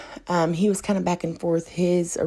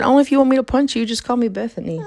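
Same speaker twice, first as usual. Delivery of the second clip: quick, high, louder retort; retold sassy reply, pitched up; mock-threatening comeback; 7 semitones higher, 3 dB louder, faster than usual. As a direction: fire back a playful threat. Retells her snappy comeback.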